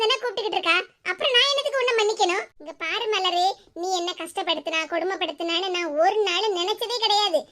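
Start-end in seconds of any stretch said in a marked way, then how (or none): 2.6-7.4 lower, softer, gentle comfort; tender reassurance. Acted. Nithya comforting her crying sister Malar, tender. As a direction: soothe her like a big sister.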